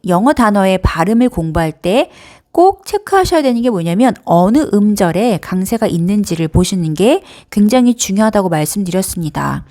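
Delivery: ordinary delivery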